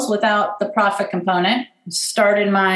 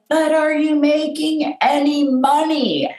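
higher, slow, drawn-out frustrated question; frustrated dad; 7 semitones higher, slower than usual. Retold or imitated. Dad to daughter about her business at dinner, frustrated. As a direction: imitate a frustrated dad, spacing the words out. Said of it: "he was so frustrated with me".

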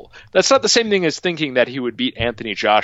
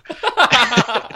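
burst of laughter; 11 semitones higher than usual. Real, not acted. Laughing at the Reddit joke.

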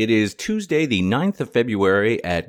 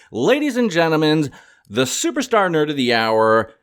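higher, louder, stretched grand introduction; announcer build-up; 8 semitones higher, 4 dB louder than usual. Acted. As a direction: announce her like a boxing champion. Announcing the guest like a ringmaster.

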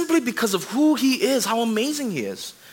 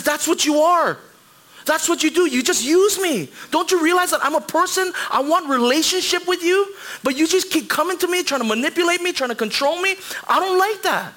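God pleading, hurt; 8 semitones higher than usual. Acted. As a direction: plead like a wounded lover. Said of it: "And he says".

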